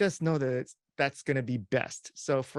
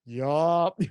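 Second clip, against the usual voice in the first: high, louder "Oh, wow"; cheesy interviewer noises; mock impressed reaction; 4 semitones higher, 3 dB louder, much slower than usual. Shown as a demonstration. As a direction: play the gushing listener. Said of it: "try your best not to, to go".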